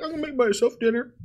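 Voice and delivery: put-on old lady voice. Guest imitating the old widow, high and sweet. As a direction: play the retiree cheerfully announcing dinner. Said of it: "So she's like".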